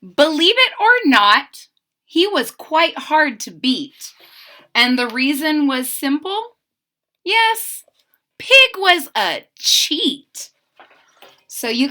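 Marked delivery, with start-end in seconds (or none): 0.0-10.5 lively rhyming read-aloud, bouncing; expressive children's story narration; storybook reading. A narrator's reading. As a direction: read to kids with sing-song energy.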